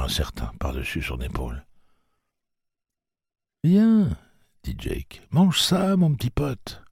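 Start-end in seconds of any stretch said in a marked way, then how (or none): 3.6-4.2 high, louder call to an animal; coaxing boy. A narrator's reading. 5.3-6.8 put-on coaxing boy. A narrator's reading. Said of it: "Jake coaxing the animal".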